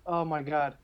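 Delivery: ordinary delivery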